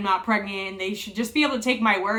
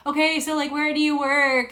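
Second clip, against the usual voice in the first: high, breezy small-talk voice; casual question to distract patient, pitched up; sneaky cheerful nurse; 6 semitones higher, 4 dB louder than usual. Retold or imitated. Staff chatting while putting on oxygen.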